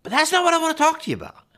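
jumps high and fast, teasing; a quick playful squeak of a turn. Acted. Pastor teasing the congregation, high playful aside. Playful twist after prayer intro.

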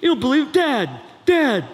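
high, loud whining Dad, Dad; whining teen. Acted. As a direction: wail at dad like a sulky teen. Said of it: "that's how it sounds".

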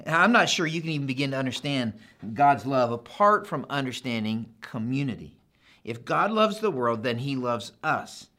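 ordinary talk, nothing marked

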